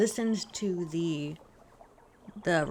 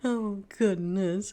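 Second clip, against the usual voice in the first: ordinary talk, nothing marked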